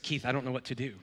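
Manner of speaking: low, hushed mock helplessness. Pastor prolonging his prank with faked despair on the phone. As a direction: play the frightened victim, quietly. Continuing fake panic.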